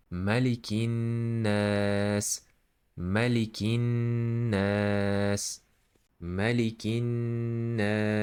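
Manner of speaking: "Malikin nas" recited with a long humming n and long aa; held nun, stretched A. Shown as a demonstration. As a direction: hold the n before drawing out "nas". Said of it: "holding the voice of this nun and stretching".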